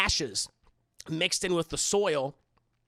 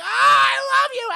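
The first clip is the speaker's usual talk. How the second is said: very high, loud cry of love; emotional outburst; 12 dB louder than usual. Retold or imitated. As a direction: squeal it out over-the-top. Mother bursts out emotionally.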